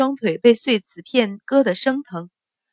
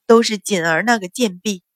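ordinary delivery

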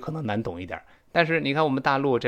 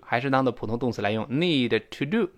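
ordinary talk

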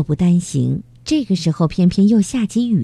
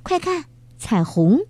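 sudden exclamation; 6 semitones higher than usual. Acted. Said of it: "芳芳突然叫道".

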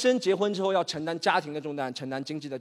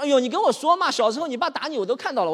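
high, loud, pushy pal voice; coaxing old buddy; 7 semitones higher, 4 dB louder than usual. Acted. Gouzi coaxing his friend to spill, high and loud. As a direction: lean in, wheedling like a nosy old friend.

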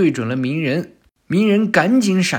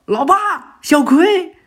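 much higher, louder desperate yell; shouting from below; 12 semitones higher, 6 dB louder, slower than usual. Acted. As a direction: cry out for dad and sister from below. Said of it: "博人也在下边喊道".

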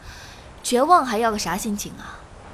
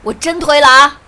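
much louder furious threat; angry shout; 4 semitones higher, 11 dB louder than usual. Acted. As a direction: roar the threat at her. Escalating dare to push. (愤怒大吼)

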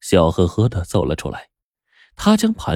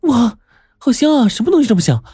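put-on much higher and fast, gushing girl; exaggerated excitement; 3 dB louder, faster than usual. Ellie excitedly asks everyone about the smell, much higher, fast. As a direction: overplay the thrill of a lovely smell. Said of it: "艾莉夸张地说".

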